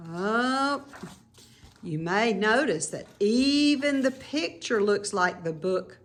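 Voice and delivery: ordinary delivery, nothing marked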